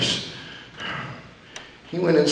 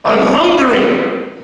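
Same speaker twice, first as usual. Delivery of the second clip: gruff wrestler; 7 semitones higher, much slower than usual. Retold or imitated. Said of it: "All of a sudden he goes".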